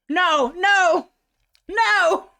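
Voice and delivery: loud, high despairing no; dismayed no. Real, not acted. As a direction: plead against fate, loudly. Dreading another spicy bean.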